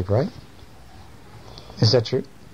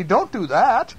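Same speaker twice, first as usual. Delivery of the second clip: put-on high, distressed old-lady voice; hammed-up high plaintive voice; old lady crying out; 12 semitones higher than usual. A narrator's reading. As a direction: protest tearfully as a storybook old lady. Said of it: "cried the old lady".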